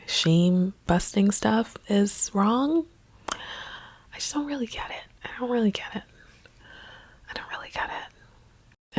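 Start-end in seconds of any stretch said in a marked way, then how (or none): 3.3-9.0 fading whisper. Real, not acted. To her viewers, baffled by censorship, fading whisper. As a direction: whisper it, fading away each time. Exasperated trailing repetition.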